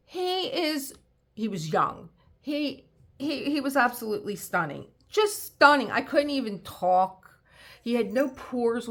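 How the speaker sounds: high excited gushing; starstruck, voice climbing with awe; swooning. Real, not acted. Swooning over Leo's beauty.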